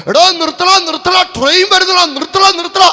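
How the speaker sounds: high, loud warning scream at a speeding rider; frantic yell. Retold or imitated. Gatekeeper screaming at a boy racing toward the train, frantic yell. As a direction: bellow it like a panicked gatekeeper. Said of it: "അലറി വിളിച്ച്".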